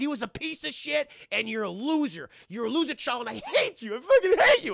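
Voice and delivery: a hammed-up tantrum; mock tantrum, building. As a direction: rant building to a childish scream.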